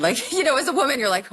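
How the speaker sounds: ordinary talk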